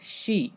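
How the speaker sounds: शी said "shee", opening on sh; शी as sh running into ee; sh plus long ee. Shown as a demonstration. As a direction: model शी with a long ee. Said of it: "S H से श बन जाएगा".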